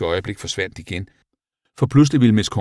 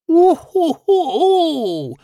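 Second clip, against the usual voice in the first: very high, long owl hoot; howling owl; 6 dB louder, much slower than usual. A narrator's reading. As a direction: wail it out like an owl. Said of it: "hylede uglen Muriel". (højt, langtrukkent uglehyl)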